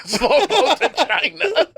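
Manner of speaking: real laughter; laughing echo. Real, not acted. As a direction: giggle the title back. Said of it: "echoes while laughing".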